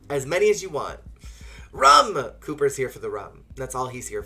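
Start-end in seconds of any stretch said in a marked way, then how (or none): 1.7-2.3 loud high exclaim of rum; rum! yelled for the joke; loud call. Acted. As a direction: shout the word. Calling out rum.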